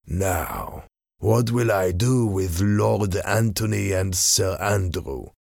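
slow, deliberate villain voice; scheming masked villain. Acted. Masked man tells his men of the useful letter, slow and scheming. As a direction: savour each word of the scheme.

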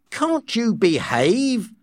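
slow, high scolding; angry. A narrator's reading. Nurse scolding the weeping savage, angry. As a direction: snap at them, stretching the words. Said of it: "the nurse said angrily".

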